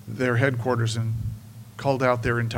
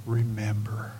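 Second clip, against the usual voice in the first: softer, hushed remember; quiet; 5 semitones lower, 7 dB quieter than usual. Shown as a demonstration. Pastor softly introducing the sermon's third point, hushed. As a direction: say remember softly, almost to yourself. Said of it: "is this".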